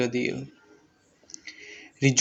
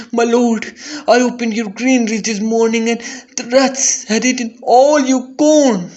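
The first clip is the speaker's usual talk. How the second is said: higher, louder, hurried alarm; alarmed servant; 8 semitones higher, 4 dB louder than usual. Acted. Servant warning his lord of the eaten corn, high and urgent. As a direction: blurt the news, breathless and frightened.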